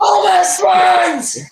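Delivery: high, drawn-out yell; over-the-top high-pitched cry; hammed-up shout. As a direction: holler it slowly, stretching each word. Keeps hollering in the shouting bit.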